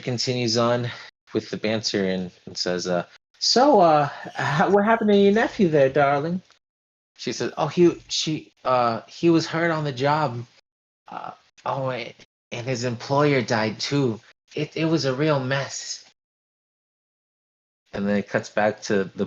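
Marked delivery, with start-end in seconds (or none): 3.4-6.4 high, loud gossipy croon; prying dance teacher. Acted. McAllister banters with Lombardi. 7.6-16.0 lightly voiced fretful lady. Lombardi explains nephew.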